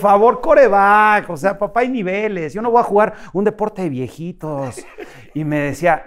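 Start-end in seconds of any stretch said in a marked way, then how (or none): 0.0-4.8 put-on higher, louder snooty kid brushing off his dad; cocky son scoffs at dad's golf invite, mock snooty; scoffing teen. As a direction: sneer at golf as an old man's game.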